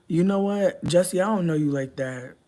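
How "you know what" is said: usual speaking voice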